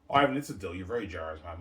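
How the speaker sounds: excited friend voice. Pretend.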